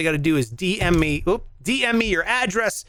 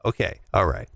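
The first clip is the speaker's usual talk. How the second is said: much lower warm-up; finding a lower voice; 11 semitones lower than usual. Shown as a demonstration. Host warming up a low voice for his impression. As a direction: drop the pitch to find the voice. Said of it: "You have a lower voice than me".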